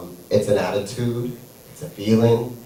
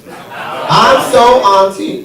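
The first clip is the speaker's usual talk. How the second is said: high, loud, drawn-out catchphrase; rousing shout; 10 semitones higher, 6 dB louder, much slower than usual. Acted. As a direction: belt it out slowly to fire up the crowd. Demonstrates crowd call and response.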